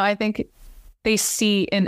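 ordinary talk, nothing marked

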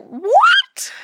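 high, loud incredulous squawk; mock shocked reaction. Imitating people told about five recordings, loudly incredulous. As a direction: imitate a stunned "what?". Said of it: "everyone's like".

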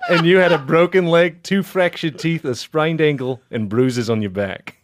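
hammed-up Southern judge listing the injuries; deliberate Southern courtroom ruling, amusement creeping in. Podcaster reading out the charges to laughing friends, Southern judge voice. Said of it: "Just do Southern Judge".

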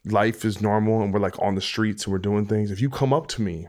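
normal talking voice

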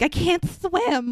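high, slow imitation of a trembling coward; mock scared whine. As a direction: draw it out, mocking her panic. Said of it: "shaking like a leaf".

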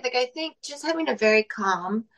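ordinary delivery

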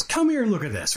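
high-pitched mock yell, volume held back; imitated yelling dad. Stepdad summoning young son to look, imitated mild yell. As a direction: play a cross dad ordering his kid over. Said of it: "he's yelling".

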